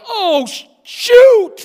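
high, loud startled yelp, swallowing the curse; startled outburst. Retold or imitated. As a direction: jolt up into a panicked near-curse. Startled at seeing him enter.

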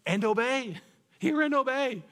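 high, loud "And obey. Hear and obey." with a laugh; excited answer. Real, not acted. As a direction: shout the answer joyfully. Answering own prompt, laughing.

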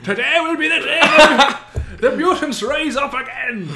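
put-on loud, high, angry Irish accent; Magneto turned Irish. Mimicking an actor's angry Magneto, loud comic Irish accent. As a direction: bellow it in a hammed-up Irish rage. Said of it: "he just turns Irish".

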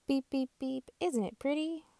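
high, cheery little truck voice; chirpy toy truck, high; Little Blue's voice. Acted.

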